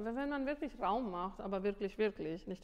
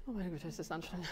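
mock strained effort; 5 semitones lower, 4 dB quieter, faster than usual. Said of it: "du machst".

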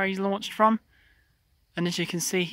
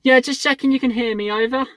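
raised, higher two-way radio voice; loud, clear radio check; radio reply; 5 semitones higher, 6 dB louder than usual. Real, not acted. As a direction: project it into the handset. Replies to pilot over radio.

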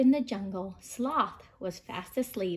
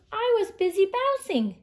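high bouncy kangaroo voice; 10 semitones higher, 4 dB louder than usual. Acted. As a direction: chirp it as the kangaroo. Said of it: "said Kangaroo".

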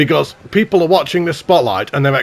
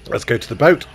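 ordinary talk, nothing marked

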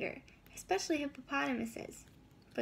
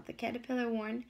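ordinary delivery

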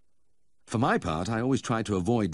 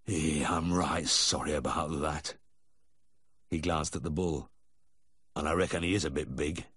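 concerned farmer; 3 semitones lower than usual. A narrator's reading. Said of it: "gazed with genuine concern".

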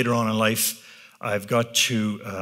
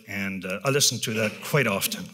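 normal talking voice

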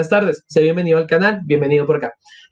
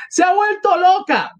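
very high exclamation; acted cry of shock; bystander's shout. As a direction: call it out high from the crowd. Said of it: "gritó otro".

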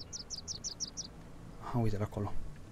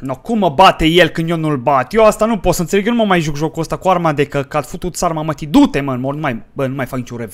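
loud, higher-pitched gamer rage; angry rant; 4 semitones higher, 9 dB louder than usual. Real, not acted. Player ranting about being beaten in the game, angry. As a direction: vent furiously at full volume. Raging after losing in game.

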